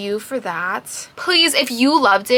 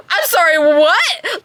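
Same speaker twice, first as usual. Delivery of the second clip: slow, drawn-out incredulous question; stunned disbelief; 6 semitones higher, 4 dB louder, slower than usual. Real, not acted. Reviewer can't believe the hero's words, slow stunned question. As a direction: ask it like you misheard.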